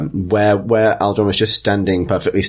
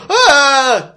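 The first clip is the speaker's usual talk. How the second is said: high, loud acted scream; sudden yell of horror; man's scream; 6 dB louder than usual. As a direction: shriek like a startled bystander. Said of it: "you just hear a man go".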